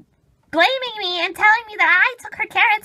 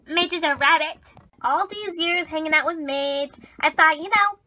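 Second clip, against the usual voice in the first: usual speaking voice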